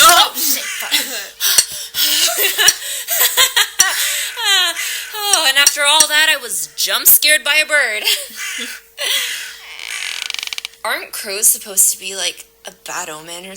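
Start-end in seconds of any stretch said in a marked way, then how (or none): 0.0-0.9 jumpy, blurted swearing after a sudden fright; a sharp jump-scare reaction; startled curse. Acted. Startled by a bird. 1.0-5.1 high, breathless laugh of relief; giggling with leftover nerves; shaky nervous laugh. Acted. Nervous laugh after jump scare. 8.1-10.8 high, breathless laugh of relief; giggling with leftover nerves; shaky nervous laugh. Acted. Laughing at the scare.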